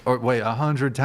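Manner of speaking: usual speaking voice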